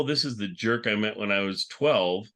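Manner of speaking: ordinary talk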